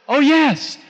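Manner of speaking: mock-emphatic, high-pitched assent; eager agreement. Woman answers whether the Word works on her heart, imitated eager agreement. Acted eager yes to his question.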